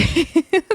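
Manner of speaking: short high real laugh. Real, not acted. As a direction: let out a quick high chuckle. Laughing at Jocelyn's remark.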